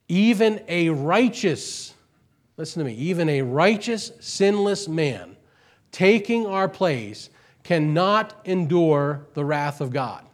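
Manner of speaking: high, loud, slowed preaching; preaching at full force. Acted. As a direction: build and press each word on the hearers. Sermon climax.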